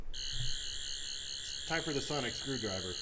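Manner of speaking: deep, slow mock-epic announcement; mock dramatic voice.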